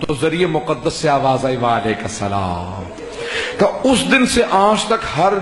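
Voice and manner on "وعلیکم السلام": low, soft, slow voice answering from the shrine; holy reply. Acted. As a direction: answer gently from the grave, low and unhurried. Imam's voice answers the son. (امام کا بیٹے کو جواب، گہری دھیمی آہستہ آواز)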